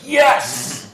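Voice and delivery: high, loud joyful yes; excited cheer. Retold or imitated. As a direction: shout it like a thrilled kid. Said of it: "he's like".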